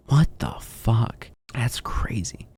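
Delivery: a breathless whisper of disbelief; whispered aside. Real, not acted. Reacting to the debt figure, a stunned whisper. Reacting to her own statement.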